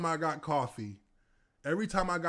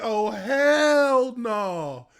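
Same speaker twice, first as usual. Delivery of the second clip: very slow, loud drawn-out no; loud disbelief; 7 semitones higher, 9 dB louder, much slower than usual. Retold or imitated. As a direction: drag it out in disbelief. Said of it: "They're like".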